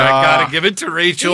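an excited call in Rachel's favour; excited verdict. Real, not acted. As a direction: announce the winner with energy. Declaring the debate winner.

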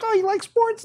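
high-pitched imitation of a friendly old lady; high mock old-lady voice; creepy-nice old lady. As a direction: mimic a nice but slightly creepy lady, voice way up. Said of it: "She was a little creepy, but she was still nice".

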